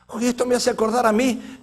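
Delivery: normal talking voice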